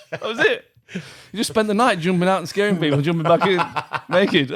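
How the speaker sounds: giggling the punchline out; laughing. Real, not acted. Retelling while laughing.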